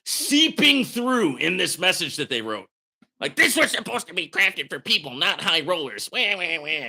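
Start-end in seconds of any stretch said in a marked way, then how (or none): 3.4-6.1 mock bitter. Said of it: "you can see the bitterness". 6.1-6.9 put-on wah wah wah, whining like a baby; mock their tears with a fake whimper; mock crying. Mocking the platform's bitter message with fake baby crying. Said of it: "cry me a freaking river".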